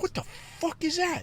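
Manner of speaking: high soft bewildered question; high stunned question about the tiny woman; baffled squeak. Retold or imitated. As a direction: squeak it out, totally lost. Sees tiny person again.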